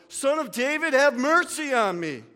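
loud, high desperate cry. Acted. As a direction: shout over everyone, pleading. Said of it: "he shouted all the more".

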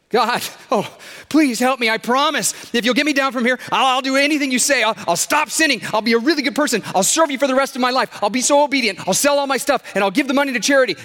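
high, loud, tumbling promises; desperate pleading. A narrator's reading. Stranded man bargains with God for rescue, high frantic pleading. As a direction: beg frantically, piling up vows.

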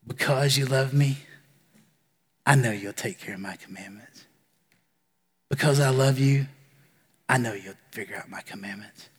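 a loving God reassuring the listener softly; tender. Acted. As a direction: breathe it gently like a loving promise. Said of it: "it's translated better".

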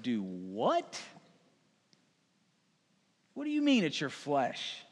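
low start leaping up into a question; baffled disbelief. Acted. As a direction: react as a stunned, puzzled crowd. Congregation's baffled reaction, acted.

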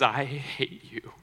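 slow, deliberate retold "I hate you"; measured, weighty imitation of a hurt boy; retold hatred. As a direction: draw out the boy's rejection without raising the voice. Boy hits friend, declares hatred.

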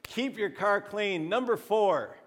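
high-pitched, punchy countdown call; game-show host. Pretend. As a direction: announce the survey answer like a host. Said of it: "kind of Family Feud style".

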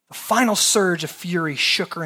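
ordinary delivery

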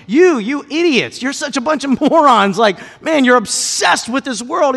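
loud, high, scornful put-down; mock scolding. A pretend Paul berating sinners, mock scorn. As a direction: sneer at the idiots. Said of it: "Paul's not saying".

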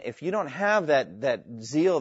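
normal talking voice

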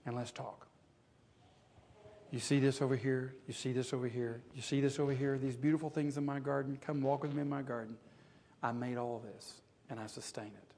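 God softly inviting Job to walk, tender; gentle God. Acted. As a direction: coax him gently into the garden. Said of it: "he just says to Job".

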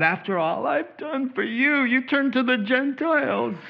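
high, far-off scolding parent voice. Retold or imitated. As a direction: lay on the parental guilt.

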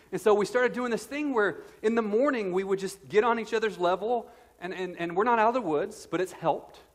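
high, loud and driving; full-force preaching. Acted. Pastor telling the church about his son, loud and high. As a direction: preach it hard to the room.

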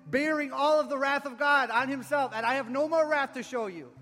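loud, high-pitched preacher's proclamation; preaching. Acted. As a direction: preach it out with full force. Preaches at full force.

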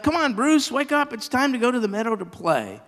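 high, carrying call to a friend. Acted. Buford Bear calling Bruce to wake up, high call. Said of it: "He called out".

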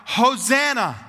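crowd's acclaim, long falling cry. Retold or imitated. The people greeting Jesus as he rides in, crying out. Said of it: "and they cry out".

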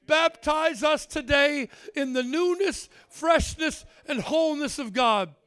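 loud, fervent preaching prayer. Acted. Pastor praying over the congregation, loud and fervent. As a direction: pour the prayer out at full force.

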